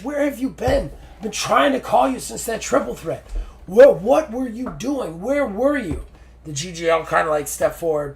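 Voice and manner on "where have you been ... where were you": higher-pitched accusing questions; demanding partner. Retold or imitated. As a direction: demand answers from your missing partner. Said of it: "Aaron walks up to him and goes".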